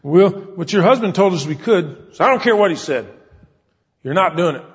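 high, loud, indignant scolding; angry woman. Retold or imitated. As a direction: tell him off like an outraged landowner. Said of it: "That's an angry woman".

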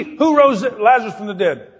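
ordinary talk, nothing marked

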